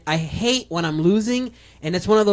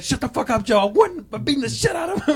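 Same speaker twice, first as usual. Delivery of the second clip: mock indignant, higher pitch; retold outburst; 7 semitones higher, 3 dB quieter than usual. Friend telling off his laughing buddy mid-game, mock indignant.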